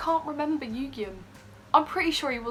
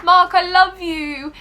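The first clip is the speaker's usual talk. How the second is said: declaring love; 8 semitones higher, 9 dB louder, slower than usual. Retold or imitated. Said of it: "so I'll go to Mark".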